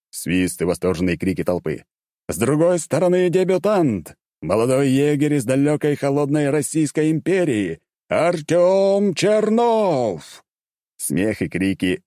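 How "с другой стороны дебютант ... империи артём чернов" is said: arena announcer. A narrator's reading. Announcer hyping the champion to the arena crowd. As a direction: boom it out to the crowd, stretched.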